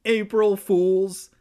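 high, loud, drawn-out taunt; gleeful prank reveal. A narrator's reading. Girl reveals her prank to cousin, crowing gleefully. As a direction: crow it out slowly, high and loud.

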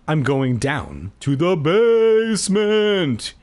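slow, high, drawn-out menace; slow eerie announcement; creepy Keith. Acted. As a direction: stretch it out like a creepy villain.

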